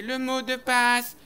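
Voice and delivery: slow, stately demand; portrait's demand. Acted.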